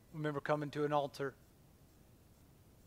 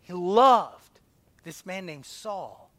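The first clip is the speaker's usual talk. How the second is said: loud, slow preacher's proclamation; rising fervent sermon voice; preaching; 4 semitones higher, 9 dB louder, slower than usual. Acted. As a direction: proclaim it from the pulpit. Preaching at full force.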